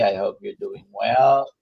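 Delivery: ordinary talk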